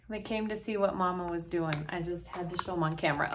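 ordinary talk

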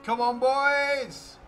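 high, drawn-out battle shout; loud "come on" to the lads; rallying shout. Real, not acted. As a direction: cheer the troops on, loud and long.

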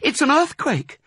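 very high mother fox voice; high-pitched cry of panic; alarmed cry. A narrator's reading. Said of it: "cried Missus Fox".

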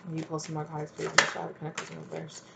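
quiet aside while drawing cards; hushed request. Real, not acted. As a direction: ask it softly, almost to yourself. Murmured request while pulling cards.

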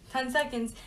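normal talking voice

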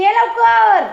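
calling out. Real, not acted.

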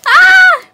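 short, high, loud scream; real shriek of delight; excited scream. Real, not acted. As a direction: burst out with a squealed "Ah!". Unboxing, thrilled by the gift.

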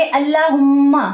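अल्लाहुम्मा with the doubled म held and nasalised; अल्लाहुम्मा with a held nasal म्म; nasal ghunna. Shown as a demonstration. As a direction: hum through the म्म in अल्लाहुम्मा. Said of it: "मीम मुशद्दद पे हमने गुन्ना किया".

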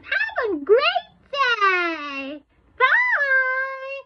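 put-on very high, loud little kid voice; Sally. Sally signing off to the viewers, high-pitched little kid voice. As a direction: squeal it out like a small child, words stretched. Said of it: "but her name is Sally".

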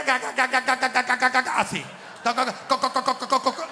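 mock stutter on ca ca ca; stuttering king. Imitating the queen's father stuttering, mock stammer. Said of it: "que el papá era gago".

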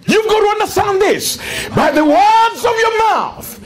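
fervent shouted sermon; rousing pulpit shout; preaching at full force. Acted. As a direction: preach it to the rafters.

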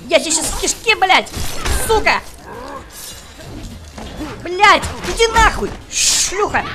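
furious high-pitched yelling at the screen; angry shouting. Real, not acted. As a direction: scream curses at the opponent. Кричит на бойца в игре. (злобный крик)

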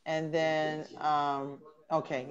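usual speaking voice

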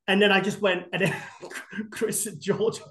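ordinary delivery

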